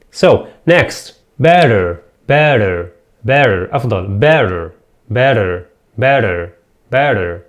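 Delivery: normal talking voice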